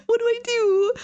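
high, loud, tearful wail; panicked. Real, not acted. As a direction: wail it helplessly, close to crying. Panicking while hunted in game.

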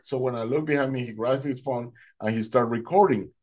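usual speaking voice

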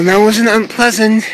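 normal talking voice

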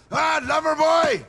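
a boss sneering at his man; mocking shout. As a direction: jeer at him. Boss taunts his driver.

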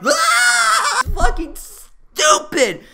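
a real outburst of disgust; exasperated scream. Real, not acted. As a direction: shriek high and loud, then drag the curse out. Exasperated outburst about the film.

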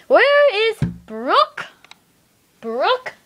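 high, slow where-is call; stern teacher call. Pretend. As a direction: demand it, drawn out high. Teacher demands absent student.

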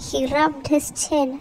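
ordinary delivery, nothing marked

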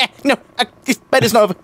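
high, stammered mock withdrawal fit; twitchy mock panic. Gamer joking about his Overwatch withdrawal symptoms, twitchy mock panic. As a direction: act out a twitchy, high-pitched protest. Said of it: "I sort of tick. I'm like".

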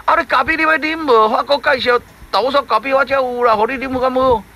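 high, pushy fish offering coffee; lightly voiced fish offering coffee; eager fish. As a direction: coax the frog with a drink. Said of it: "三角鱼讲".

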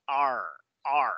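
"R" as English ar; curled-tongue sound. Shown as a demonstration. Teacher coaching tongue position, curled-tongue ar. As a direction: say "R" rolling tongue backwards, no trill. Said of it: "la enrollas en un taquito hacia atrás".

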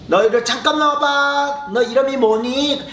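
high, slow taunting bully voice; menacing older boy. Acted. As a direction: lure him in slowly. Bullies call a kid over.

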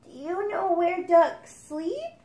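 a small duck asking eagerly; duckling voice. Acted. Duckling asks mother.

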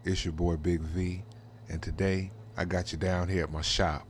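ordinary delivery

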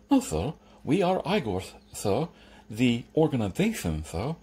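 lisping, deferential correction; Igor. Acted. Igor explaining his organization to Jeremy, lisping deference. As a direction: patiently correct your master with a lisp.

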